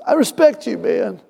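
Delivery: high mock-tearful voice; imitated crying. Tearful man thanks his pastor, imitated crying voice. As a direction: imitate a man overcome with gratitude. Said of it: "And he started crying".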